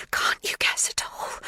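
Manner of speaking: airy, breathless hush; breathless whisper. A narrator's reading. Magda breathless as she presses the girl. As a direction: ask it short of breath. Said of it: "a little breathless".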